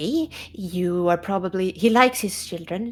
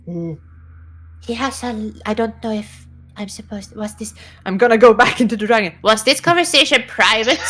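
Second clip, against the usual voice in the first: ordinary delivery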